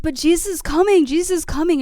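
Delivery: tripping friend frantically announcing Jesus; frantic high exclamation. Retold or imitated. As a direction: blurt it out like a wild revelation. High friend shouting Jesus coming.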